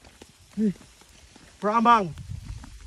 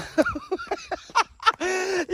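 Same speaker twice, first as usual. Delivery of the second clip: high-pitched burst of laughter; big high giggle; 10 semitones higher than usual. Real, not acted. Fooling around on the hike.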